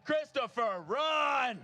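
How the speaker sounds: loud cheering shout, high pitched. Real, not acted. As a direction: yell it across the finish line. Urging a runner, repeated louder.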